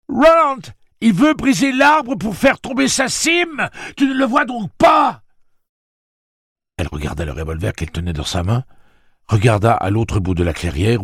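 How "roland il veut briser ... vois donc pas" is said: very high, loud desperate shout; cri désespéré. A narrator's reading. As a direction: cry out slowly, pleading to be understood. Desperate shouted plea amid attack. (supplication criée, très aiguë)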